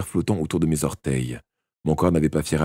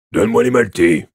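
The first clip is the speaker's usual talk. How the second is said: loud, raised, sharp demand; cutting tone; 4 semitones higher, 8 dB louder than usual. A narrator's reading. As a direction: demand it sharply, louder and higher. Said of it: "répliqua Snape d'un ton tranchant".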